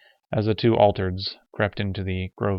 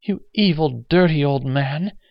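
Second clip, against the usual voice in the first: horrified mutter; 7 semitones higher, 5 dB louder than usual. Acted. Woman confronts the old man, muttering in horror. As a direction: condemn him in horror. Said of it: "a woman muttered at him".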